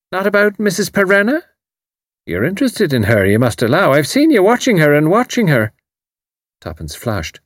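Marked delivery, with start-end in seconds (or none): 0.1-5.7 Irish landlady. A narrator's reading. Mrs O'Rourke voiced.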